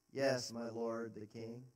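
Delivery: low, soft and slow; bow your voice to the king; David answering Saul humbly; respectful. Acted. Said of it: "Still respectful".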